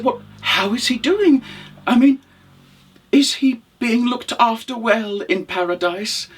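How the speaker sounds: high, eager questioning voice; concerned widow. Acted. Widow asking after husband in paradise, concerned. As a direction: ask anxiously, pitched well up.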